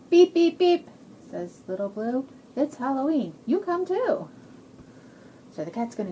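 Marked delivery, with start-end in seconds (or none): 0.1-0.9 high, loud truck beeps; little truck horn. Acted. 2.6-4.3 high-pitched eager invitation; excited little truck. Acted. Truck invites friends in story.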